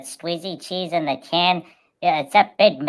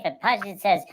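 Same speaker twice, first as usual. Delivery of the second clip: ordinary talk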